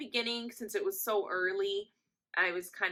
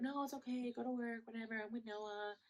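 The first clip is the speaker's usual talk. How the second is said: higher, soft, brushing it off; retold reassurance; 5 semitones higher, 10 dB quieter than usual. Telling boyfriend to go to work, higher soft reassurance. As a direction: reassure lightly in a small high voice.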